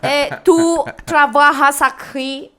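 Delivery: put-on gringo accent Spanish, slow and careful; Spanish with a thick American accent. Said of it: "with that gringo accent".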